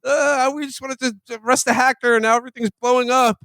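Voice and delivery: hammed-up shout of alarm as the agent; mock panic. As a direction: FBI agent hamming up panic at the chaos. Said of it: "and she's like".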